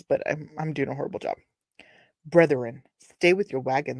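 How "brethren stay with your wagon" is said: ordinary delivery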